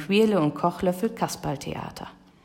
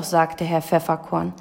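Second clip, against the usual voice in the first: usual speaking voice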